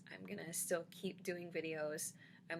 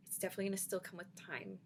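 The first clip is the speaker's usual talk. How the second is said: ordinary delivery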